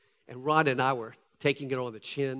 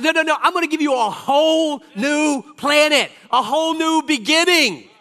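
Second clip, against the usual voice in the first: higher, louder divine proclamation; booming God voice; 10 semitones higher, 11 dB louder than usual. Acted. Pastor voicing God to the church, booming and loud. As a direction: shout it as God promising a new planet. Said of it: "God says".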